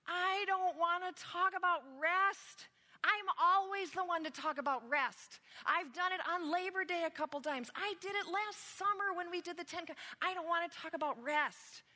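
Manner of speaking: high, loud, hammed-up whine; whining. Pastor re-enacting her protest at being given rest, whining. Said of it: "but I started whining".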